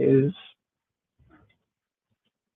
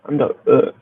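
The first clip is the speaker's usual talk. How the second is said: underwater; 3 semitones higher than usual. Real, not acted. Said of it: "why do I sound like I'm underwater?".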